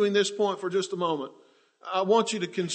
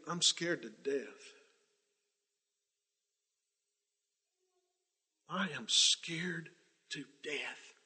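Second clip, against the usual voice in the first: hushed frightened plea; 3 semitones lower, 13 dB quieter, slower than usual. Retold or imitated. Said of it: "maybe he's saying".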